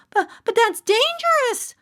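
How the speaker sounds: put-on high stammering child voice; protesting boy. A narrator's reading. Johnny Brown protesting to the mayor, high and stammering. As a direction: protest nervously, tripping on but. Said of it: "Johnny Brown protested".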